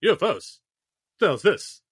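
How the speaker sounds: bewildered, laughing at the end; mock startled Obama. Obama alone in his room hearing about UFOs, mock puzzled. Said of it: "just like".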